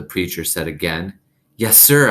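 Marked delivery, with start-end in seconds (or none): 1.6-2.1 louder yes sir, pitched up. A narrator's reading. Opal answers the preacher again, louder and higher. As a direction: raise the voice to be heard. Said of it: "I said louder".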